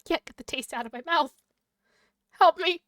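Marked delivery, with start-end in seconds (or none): 2.4-2.8 mock cry for help. Real, not acted. Gamer distressed by scene.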